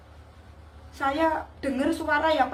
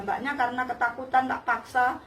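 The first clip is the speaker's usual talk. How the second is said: usual speaking voice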